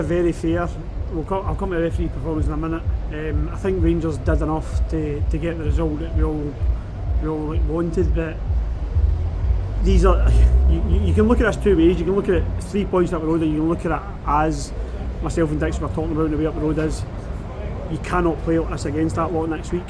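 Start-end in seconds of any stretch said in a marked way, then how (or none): none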